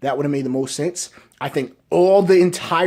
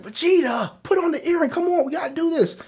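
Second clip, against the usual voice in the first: higher, urging voice for Goku; lightly voiced Goku begging Vegeta to put on the earring; pleading Goku; 11 semitones higher than usual. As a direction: coax your partner to fuse, pitched way up. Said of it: "Goku's like".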